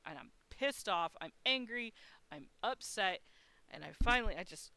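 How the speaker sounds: ordinary talk, nothing marked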